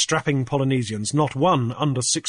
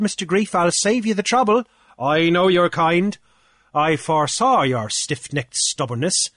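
pompous official, pitched higher, boasting; blustering Cornelius; 5 semitones higher, 3 dB louder than usual. Acted. Cornelius gloating to Grief over the fines, higher and pompous. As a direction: puff up like a petty island official lording it.

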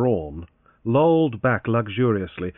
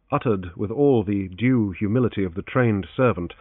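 usual speaking voice

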